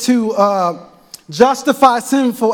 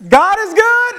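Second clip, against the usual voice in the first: God is good called much higher and louder; with your chest; 12 semitones higher, 4 dB louder than usual. Shown as a demonstration. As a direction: belt it from the chest to lift the room. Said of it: "I want you to say it with your chest".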